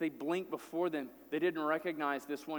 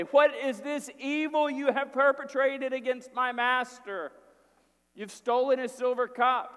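loud, higher accusation; accusing steward; 8 semitones higher, 6 dB louder than usual. Retold or imitated. Steward shouting the charge at the brothers, loud accusing. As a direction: call out the charge like a servant on horseback.

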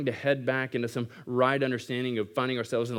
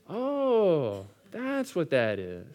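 dawning realization; 4 semitones higher, much slower than usual. Acted. Clueless guy catches on.

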